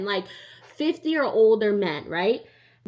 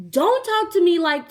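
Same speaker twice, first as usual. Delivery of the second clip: yelling back; 8 semitones higher, 6 dB louder than usual. Retold or imitated. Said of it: "Yelling back at him".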